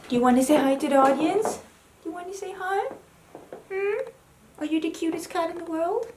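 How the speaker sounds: high-pitched cooing to a cat; pet-talk. Real, not acted. Talking to her pet cat.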